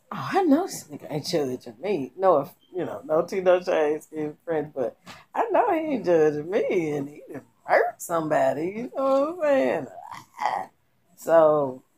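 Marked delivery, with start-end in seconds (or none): 0.1-9.9 high, sassy, mock-offended voice; sassy retort. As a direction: play her as indignant and sassy. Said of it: "so she like".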